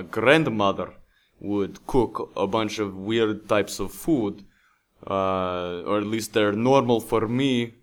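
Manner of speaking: hammed-up Russian accent; fake Russian accent. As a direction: play a Russian guy introducing himself, thick Russian accent. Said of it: "do I need to work on my Russian accent".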